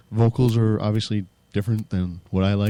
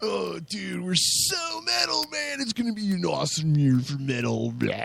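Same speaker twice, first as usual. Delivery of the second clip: higher pitched dopey dude voice; mocking metal fans in a higher dopey voice; metalhead bro talk; 6 semitones higher, 5 dB quieter than usual. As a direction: play a stoner metalhead. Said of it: "I'm talking like a real metalhead".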